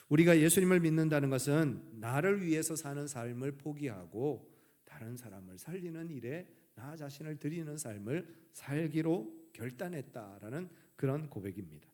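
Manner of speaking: ordinary talk, nothing marked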